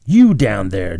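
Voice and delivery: loud call down to the robbers; thundering call. A narrator's reading. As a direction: thunder down at them. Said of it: "George Alderson thunder".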